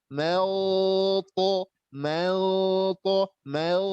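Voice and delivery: MEL held long and heavy before to; thick ikhfa. Shown as a demonstration. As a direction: hum the hidden n of mel thickly. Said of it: "ikhfanya tebal".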